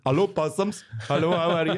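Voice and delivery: loud, high fake Australian greeting; hammed-up Australian dame, high and loud; shitey Australian accent. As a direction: do a bad Australian accent that sounds Indian. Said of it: "with my shitey Australian accent that sounds Indian".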